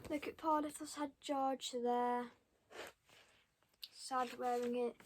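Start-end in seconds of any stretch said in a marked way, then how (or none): none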